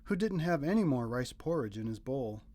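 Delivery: ordinary delivery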